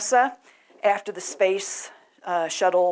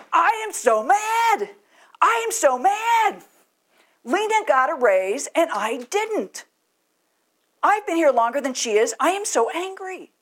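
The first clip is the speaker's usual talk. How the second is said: irate worker; 9 semitones higher, 3 dB louder than usual. Retold or imitated. Irate worker yelling at the manager about a raise, hammed-up high shout. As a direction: storm in as a worker who got passed over. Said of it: "The irate worker would say".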